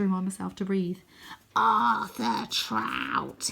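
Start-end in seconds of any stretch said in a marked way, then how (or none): 1.6-3.5 slow, drawn-out creepy rasp; rasping monster. Acted. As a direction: stretch the name like a menacing scarecrow. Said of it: "he rasps".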